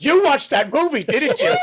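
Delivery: high, loud accusing shout; hammed-up parental yelling; furious father. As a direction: imitate an enraged dad catching his kid out. Acting enraged father waking him.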